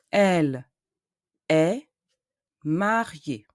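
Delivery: slow French, syllable by syllable with long gaps; syllabe par syllabe. Shown as a demonstration. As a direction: sound out each French syllable separately, deliberately. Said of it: "emphasize the syllables".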